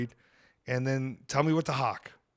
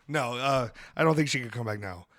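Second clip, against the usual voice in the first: ordinary talk, nothing marked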